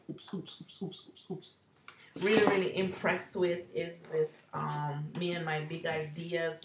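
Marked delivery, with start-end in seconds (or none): 0.1-1.6 breathy whispered oops under the breath; soft whispered oopsies. Real, not acted. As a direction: murmur the oops while fumbling. Dropping something while filming.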